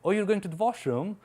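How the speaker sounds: slow put-on British English; British accent, slow and teasing; imitating British accent. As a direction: ask it in a drawn-out British accent. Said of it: "British accent में बोली".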